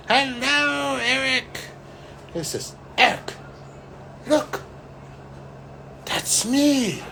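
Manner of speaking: put-on high, cheery old man greeting; lightly voiced little old man, welcoming; cheerful old man. Shiva greeting Eric at the waterfall, high cheerful voice.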